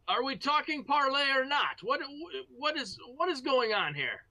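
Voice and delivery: high-pitched, hammed-up enemy shout; yelling back. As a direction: yell across in baffled impatience. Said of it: "one of them yells back".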